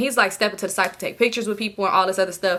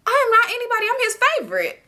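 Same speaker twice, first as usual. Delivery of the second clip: high, sassy put-on retort; high-pitched mock indignation; mock comeback; 13 semitones higher, faster than usual. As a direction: protest way up high, playing the favorite. Her mock comeback to the woman.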